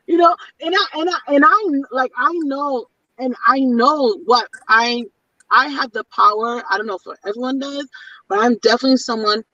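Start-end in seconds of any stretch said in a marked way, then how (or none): none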